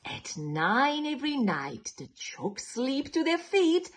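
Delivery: lively picture-book storytelling; reading to children. A narrator's reading. Storyteller reading to children, lively and sing-song. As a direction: read it like bedtime story time for little ones.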